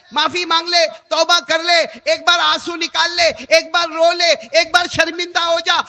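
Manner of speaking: high, loud calling out to repent; impassioned preaching call; loud plea. Acted. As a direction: cry out the call to the careless crowd. Divine call to repent, cried out.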